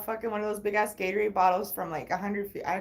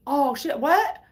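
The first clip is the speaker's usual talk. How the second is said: higher, louder oh-shit, rising on what; hammed-up surprise from the friend; mock startled; 6 semitones higher, 6 dB louder, much slower than usual. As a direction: caught off guard, blurting it. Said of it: "dude was like".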